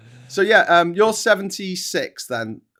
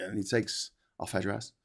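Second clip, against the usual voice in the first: ordinary delivery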